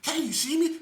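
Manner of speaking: high, loud sing-song mockery; mocking taunt. Woman mocking the speaker's thick glasses, high and loud. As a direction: tease him about his glasses. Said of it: "And then she said".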